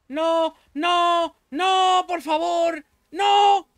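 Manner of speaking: high, loud desperate cries of no; panicked shouting. Real, not acted. Panicked screams at a seagull pecking him. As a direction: yell in real panic as the gull attacks.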